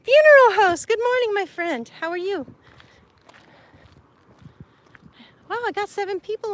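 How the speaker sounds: bright hello to a newcomer; excited welcome. Real, not acted. Welcoming a viewer who joined the stream, excited.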